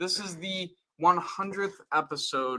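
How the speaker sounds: high-pitched news anchor read; newscaster voice. Acted. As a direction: announce the milestone like an anchor. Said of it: "newscaster voice still".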